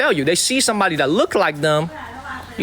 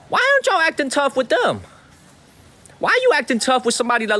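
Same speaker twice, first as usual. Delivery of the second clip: high, riled challenge; worked up; 7 semitones higher than usual. Real, not acted. Streamer challenging online critics, worked up. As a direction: demand an answer in a raised pitch. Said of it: "I'm sorry for getting worked up".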